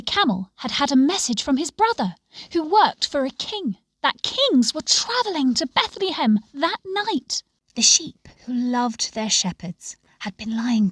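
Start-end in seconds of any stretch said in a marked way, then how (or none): none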